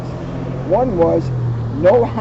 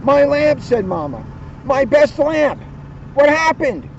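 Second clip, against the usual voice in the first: upset Mama; 8 semitones higher than usual. Acted. Mama finding her best lamp broken, high and upset. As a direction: cry out over the broken lamp.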